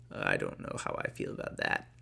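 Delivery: unvoiced whisper to the listener; whispered aside. Real, not acted. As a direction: murmur a private doubt under your breath. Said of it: "reader's aside, whispered".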